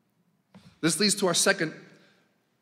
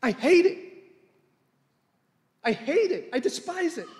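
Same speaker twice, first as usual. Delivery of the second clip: God's anger; 12 semitones higher than usual. Acted. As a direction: throw out the words like a furious God. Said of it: "He's saying".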